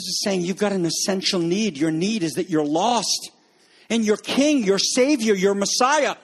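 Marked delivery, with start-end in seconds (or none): none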